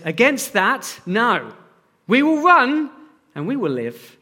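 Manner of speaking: film warrior's reply in a shaky attempt at Scottish; amateur Scottish accent for a movie quote; dodgy Scottish accent. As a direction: have a go at a Scottish accent, not very convincingly. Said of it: "not very good with my Scottish accent there".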